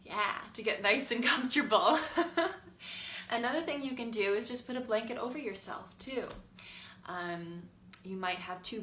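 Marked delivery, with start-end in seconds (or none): 2.0-3.2 high, light laugh; real laugh. Real, not acted. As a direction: let a short laugh bubble up. Laughs after getting comfortable.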